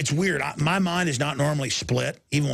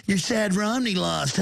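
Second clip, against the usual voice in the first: higher, slowed sneering voice of a dismissive listener; mock the scoffing public, a notch higher; mocking skeptic; 7 semitones higher than usual. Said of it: "And they're just like".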